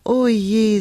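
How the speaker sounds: drawn-out dismayed sigh; mildly woeful lament. A narrator's reading. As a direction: sigh it, the second time stronger. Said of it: "seufzte sie".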